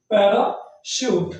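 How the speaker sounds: Parachute drawn out very slowly, each syllable clear; slow pronunciation. Shown as a demonstration. Teacher slowly spelling out parachute for students. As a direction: stretch parachute out slowly for the class. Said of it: "इसे हम पढ़ते हैं".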